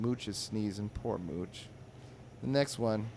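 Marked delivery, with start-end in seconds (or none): none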